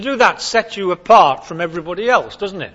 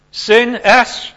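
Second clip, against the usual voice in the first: loud acronym; 6 semitones higher, 7 dB louder, much slower than usual. Shown as a demonstration. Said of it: "Sin, S, shove off God".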